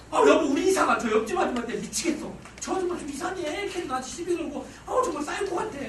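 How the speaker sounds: high-pitched, exasperated venting; hammed-up wifely whine; complaining wife. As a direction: act out a fed-up wife griping to her husband. Voices wife complaining about a neighbour.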